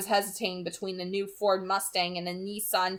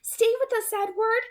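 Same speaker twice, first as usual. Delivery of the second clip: Alice cautioning; 12 semitones higher than usual. A narrator's reading. Alice warning Edward to stay with them, high and firm. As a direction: warn him in Alice's high voice. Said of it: "Alice cautioned".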